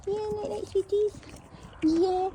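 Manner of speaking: sing-song pet voice. Real, not acted. Cooing goodnight to horses.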